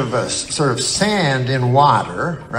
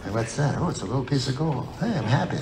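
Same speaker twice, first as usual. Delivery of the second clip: soft small delight; 10 dB quieter, faster than usual. Retold or imitated.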